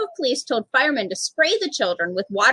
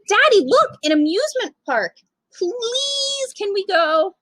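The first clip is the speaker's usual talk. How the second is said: a small daughter pleading, pitched way up; little girl's voice; 8 semitones higher than usual. Acted. Daughter begging her daddy to go to the amusement park, high and pleading.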